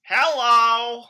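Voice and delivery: booming high hello; loud greeting. Acted. Greeting the president from the corner, booming. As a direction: blast a cheery hello across the room.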